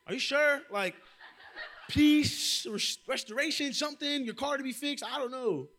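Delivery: high, loud, comic persistence; flustered offering. Retold or imitated. Offering prayer to an angry driver, high flustered insistence. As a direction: pitch it way up and keep grasping for suggestions.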